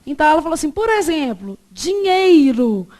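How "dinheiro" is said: "dinheiro" drawn out with the I of "ei" clearly sounded; over-enunciated "dinheiro", every vowel pronounced; full diphthong. Shown as a demonstration. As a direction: say "dinheiro" slowly and loudly as "di-nhei-ro". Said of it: "Você não fala dinheiro".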